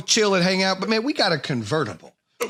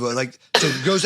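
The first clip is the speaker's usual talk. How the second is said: ordinary talk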